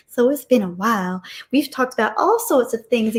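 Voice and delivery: put-on playful, lilting Southern accent; chatty Southern accent with swooping pitch. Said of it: "a Southern accent".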